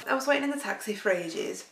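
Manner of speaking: ordinary delivery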